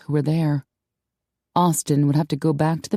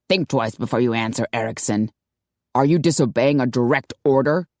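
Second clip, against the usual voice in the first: a slightly lower, hinted Sean, scornful; scathing commander, lightly lowered; lightly voiced gruff boss; 4 semitones lower than usual. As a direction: sneer it as the suspicious commander.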